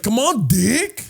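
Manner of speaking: slow, high chunti drawl. Retold or imitated. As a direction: drag it out chunti style. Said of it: "it's like".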